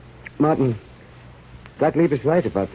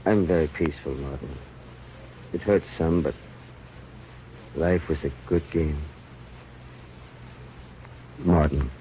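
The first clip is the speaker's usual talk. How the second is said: low, much softer, peaceful fade; fading at death's door; 9 semitones lower, 8 dB quieter than usual. Acted. Dying man taking leave of Martin, low and fading. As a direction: drift away gently as life leaves.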